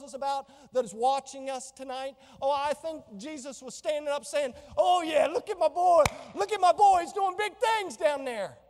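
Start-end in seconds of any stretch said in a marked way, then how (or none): 4.8-8.6 cheering shout. Pretend. Jesus cheering his boy on.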